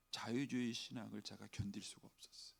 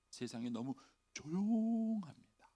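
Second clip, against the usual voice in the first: slow lament; 5 semitones higher, slower than usual. Shown as a demonstration. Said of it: "repeated as whisper".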